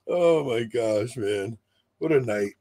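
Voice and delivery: usual speaking voice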